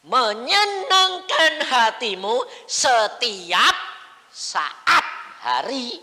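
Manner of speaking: slow, high, loud drawn-out cry; preacher's drawn-out cry; preaching climax. Real, not acted. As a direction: stretch every syllable, soaring. Same phrase proclaimed again.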